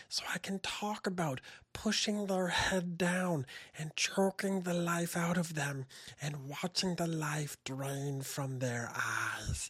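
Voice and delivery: slow, soft, sinister whisper; cute voice turned menacing; creepy cute whisper. Shown as a demonstration. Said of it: "I can use this voice and I can say horrible, horrible things".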